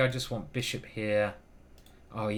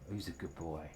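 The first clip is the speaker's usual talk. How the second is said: soft pet praise; 7 semitones lower, 12 dB quieter than usual. Real, not acted. Praising the cat.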